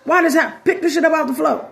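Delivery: high, nagging mom voice; scolding mama. Retold or imitated. Mama scolding kids about the mess, high and nagging. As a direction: fuss at the kids like a tired mama. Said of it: "regular mama stuff".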